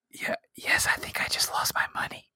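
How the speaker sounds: softly whispered aside. Acted. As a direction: whisper it like a sheepish confession. [name] whispers losing a bet.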